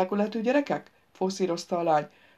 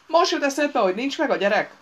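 high, louder furious yell; 7 semitones higher, 3 dB louder than usual. A narrator's reading. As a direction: shout it at him in panic. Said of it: "Ordította".

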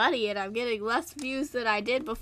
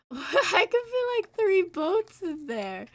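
high-pitched, giddy disbelief; amazed; 6 semitones higher, 3 dB louder, slower than usual. Real, not acted. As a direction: marvel at the size, voice jumping up. Amazed at the huge dock.

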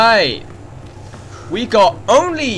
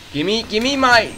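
ordinary talk

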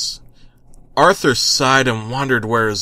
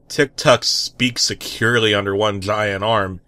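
ordinary delivery, nothing marked

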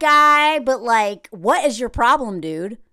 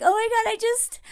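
high breathy-free mock gush, much higher; mock Laura voice; 10 semitones higher than usual. Mocking Laura in the pods, high put-on voice. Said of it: "she was like".

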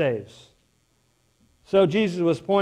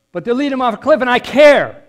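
raised, louder preacher's cry, higher pitch; preaching at full force; 7 semitones higher, 9 dB louder than usual. Acted. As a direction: call it out to the congregation.

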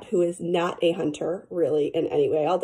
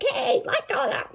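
much higher, squeaky mock yell; retold scream. Owner yelling at the cat over the chipmunk, high mock yell. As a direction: yelp it at the cat, not full volume. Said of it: "I was screaming".

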